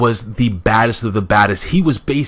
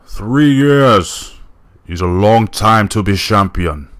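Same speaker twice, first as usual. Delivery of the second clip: put-on wrestling giant voice, loud; loud imitation of a giant wrestler; Andre the Giant; 4 dB louder, slower than usual. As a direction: belt it out as your best giant impersonation. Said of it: "There goes my best Andre".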